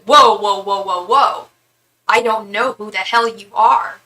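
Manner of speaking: loud, alarmed backing-off whoas; startled whoa. Retold or imitated. Friend fending off the stranger in the store, startled loud protest. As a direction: play the friend pushing him away in shock.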